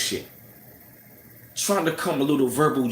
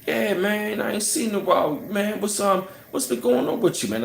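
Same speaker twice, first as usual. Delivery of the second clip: casual friendly greeting; 7 semitones higher than usual. Retold or imitated. Friendly guy greeting narrator.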